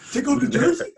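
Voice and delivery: high, loud mock outrage; hammed-up disbelief as the drunk guy; riled drunk dude. Drunk dude told to remove his jersey, loud high imitation.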